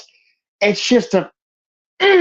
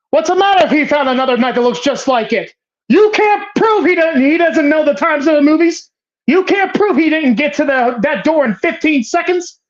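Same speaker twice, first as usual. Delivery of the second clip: higher, fast heated rant; mock furious rant; 6 semitones higher than usual. Juror ranting at the other jurors, higher and fast. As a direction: hurl it like a cornered juror.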